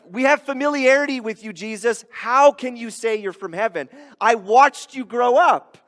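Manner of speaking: high, loud mock challenge; indignant disbelief. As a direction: confront him as a disbelieving old neighbour. Acts neighbours challenging Jesus' claim.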